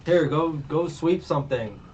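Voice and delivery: mock exasperation. Boss giving up on Luffy's dishwashing, mock exasperation. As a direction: scold like a fed-up boss, through amusement.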